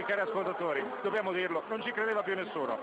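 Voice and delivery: normal talking voice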